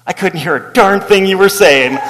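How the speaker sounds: blunt parishioner. Retold or imitated. Tweed-jacket man greeting the new pastor, blunt. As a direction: deliver the insult cheerfully. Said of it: "he's like".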